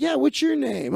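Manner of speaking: high, bright friendly question; chirpy host asking a name; cheery magician voice. Retold or imitated. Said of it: "And I'm like".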